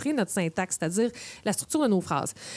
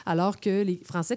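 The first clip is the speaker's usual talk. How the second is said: usual speaking voice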